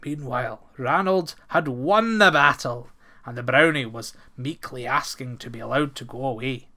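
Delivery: usual speaking voice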